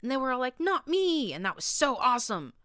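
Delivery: put-on young mare gushing to her friends, shrieking with glee; gleeful shriek. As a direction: gush like a thrilled kid retelling a battle. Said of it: "Sonata shrieked as she bounced in glee".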